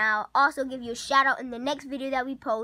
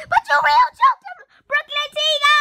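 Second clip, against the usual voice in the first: much higher and louder, furious; angry shriek; 12 semitones higher, 7 dB louder than usual. Real, not acted. Boy scolding Brooklyn Tina for choking Jeffy, shrieking. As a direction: shriek the accusation at her.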